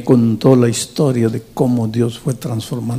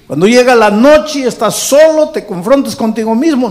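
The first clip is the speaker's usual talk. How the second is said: much higher, louder preaching; impassioned preaching; 10 semitones higher, 5 dB louder than usual. Acted. Pastor describing the lonely night to listeners, impassioned preaching. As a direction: paint the lonely night with fire.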